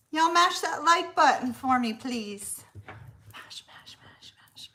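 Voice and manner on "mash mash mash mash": whispered chant. Real, not acted. Streamer urging viewers to press like, whispering.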